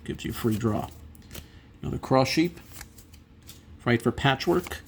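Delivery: ordinary delivery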